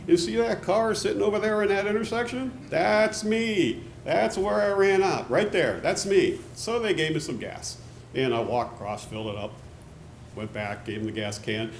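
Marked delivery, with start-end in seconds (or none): 0.1-6.4 higher, insistent pointing out of the stalled car. Retold or imitated. To the attendant, insisting that stalled car is his, higher. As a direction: stress that it's my car over there.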